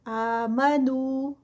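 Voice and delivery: آمنو with آ and نو each held two counts; two-count stretches. Shown as a demonstration. Teacher modelling two-count madd on آمنو. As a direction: stretch آمنو evenly, two beats per vowel. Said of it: "آ پہ ٹو counts".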